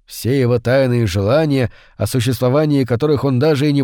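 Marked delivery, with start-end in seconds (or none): none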